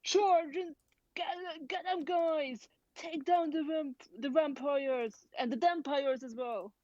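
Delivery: high-pitched rallying cry in character; slow, high call to charge, easing down; battle shout. Acted. Player as Mug rallying crew against vampires, shouting. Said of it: "Mug shoots the cannonball and then shouts".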